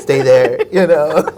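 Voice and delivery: dad's warning quoted through laughter; laughing quote. Retold or imitated. Son quoting his paranoid chef dad, laughing.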